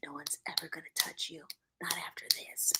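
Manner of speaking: fast, voiceless ASMR whisper. A narrator's reading. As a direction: hush it right into the mic. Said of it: "A S M R time".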